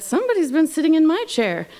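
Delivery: very high storybook mother bear; high, indignant mama bear. A narrator's reading. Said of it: "Mama Bear said".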